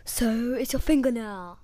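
slow mock Austrian accent; Schwarzenegger. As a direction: drag it out in an Austrian action-star voice. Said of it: "saying it like Schwarzenegger".

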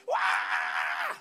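long, strained mock bawl; drawn-out "waaah"; imitated baby wail. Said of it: "dengar dia nangis terus".

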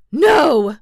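loud, high, dramatic cry of disbelief; astonished cry. A narrator's reading. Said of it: "cried the astonished king".

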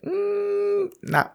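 high hummed dismissive nah; squeaky mock refusal; mock dismissal. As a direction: brush it off like a smug skeptic. Mimicking dismissive people.

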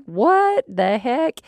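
high, slow, baffled "what the heck"; imitated surprise. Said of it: "I think he must have been like".

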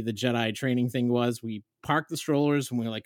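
ordinary talk, nothing marked

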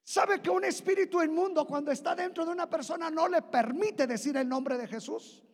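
high, loud, shouting sermon; preaching at full force. Acted. Preacher shouting the name of Jesus to the church. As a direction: proclaim the power of the name.

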